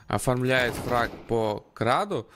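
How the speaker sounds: ordinary talk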